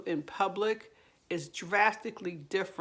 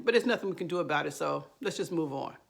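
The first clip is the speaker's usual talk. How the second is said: very quick dismissal, dropping away at the end; immediate rushed add-on; much faster than usual. Acted. Narcissist cutting off any response to an apology, hurried. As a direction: rush through it to close the subject. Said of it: "they immediately add in".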